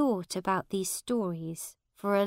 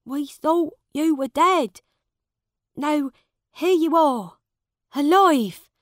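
1 happy relief; 8 semitones higher, 10 dB louder than usual. A narrator's reading. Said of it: "He was very happy to see Miles again".

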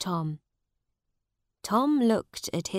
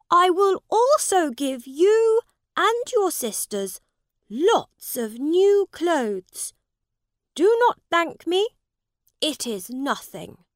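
high, loud princely voice; proud prince handing out favours; generous young prince; 10 semitones higher, 8 dB louder than usual. Acted. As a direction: offer it grandly, loud and high. Prince offers gifts to Tom.

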